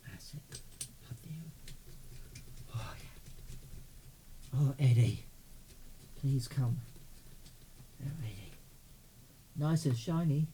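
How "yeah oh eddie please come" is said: usual speaking voice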